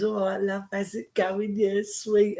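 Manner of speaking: a hint of a smooth club charmer; chatting-up lad. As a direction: toss it out casually like a flirt.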